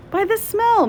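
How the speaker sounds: put-on very high creaky old lady; odd old visitor answering Meg; quaint old woman. A narrator's reading. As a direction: chirp it out merrily.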